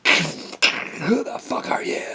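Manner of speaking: slow, hushed incredulous whisper. Retold or imitated. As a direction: breathe it out in disbelief. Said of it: "and I'm like".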